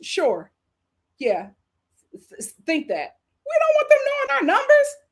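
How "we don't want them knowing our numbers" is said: high, squeaky put-on voice; high-pitched mock exclamation. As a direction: shoot way up high and louder.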